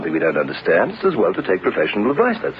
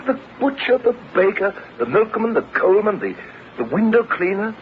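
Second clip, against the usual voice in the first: much higher-pitched, item-by-item rattled list; exasperated listing; 10 semitones higher than usual. Acted. Husband countering wife's worry, exasperated high-pitched list. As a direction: reel off the obvious answers, voice pitched right up. Husband mockingly lists other possible sources.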